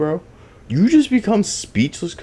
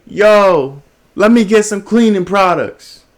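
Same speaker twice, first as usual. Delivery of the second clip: loud, higher-pitched demanding yell; retold screaming; 6 semitones higher, 6 dB louder than usual. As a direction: imitate a man yelling out a demand.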